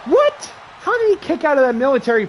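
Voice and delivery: high cracking "what?" in shock; can't believe the kickout; stunned disbelief. Real, not acted. Commentator shocked as wrestler kicks out, high disbelieving yelp.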